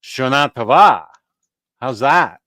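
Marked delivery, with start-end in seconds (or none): 0.0-1.1 "Shana Tova" as sha-NA to-VA, last syllables punched; stress on second half. Shown as a demonstration. Host retrying the Hebrew greeting after correction, final-syllable stress in "Shana Tova". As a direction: land firmly on the endings of "Shana Tova". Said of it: "In Hebrew, it's the second half".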